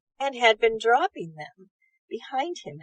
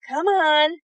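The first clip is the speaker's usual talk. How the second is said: louder, higher call of an eldest brother; rally the little ones, lightly raised; cried out; 5 semitones higher, 8 dB louder than usual. Acted. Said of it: "cried Tom".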